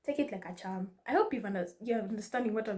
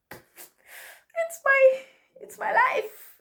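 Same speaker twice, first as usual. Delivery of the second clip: squeaky high protest; 14 semitones higher, 6 dB louder, much slower than usual. Acted. Playful protest about her accents.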